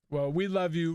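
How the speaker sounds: ordinary talk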